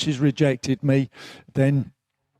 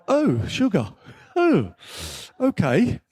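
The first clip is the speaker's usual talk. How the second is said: taken aback; 4 semitones higher, slower than usual. Retold or imitated. Reenacts his reaction to being rejected.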